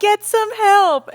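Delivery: high, loud, drawn-out screech. Retold or imitated. Sister imitating her own screech from the stairs, high loud. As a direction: screech it with mock outrage. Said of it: "the way she screeched it".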